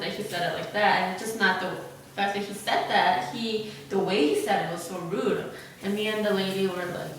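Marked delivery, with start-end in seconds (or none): none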